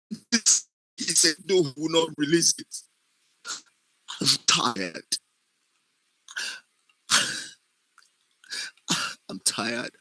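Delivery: weary tearful account with long sobbing pauses; crying. Shown as a demonstration. Acting student's demo of a slapped, wronged victim, crying. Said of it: "and I'm crying".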